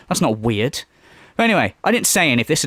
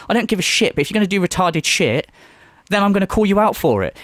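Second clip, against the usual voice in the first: ordinary talk, nothing marked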